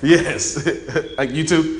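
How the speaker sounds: laughing. Real, not acted. Laughs at being a nerd.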